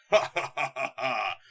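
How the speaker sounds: hammed-up evil cackle; villain laugh. Dark lord gloats over the heroes with a hammed-up cackle.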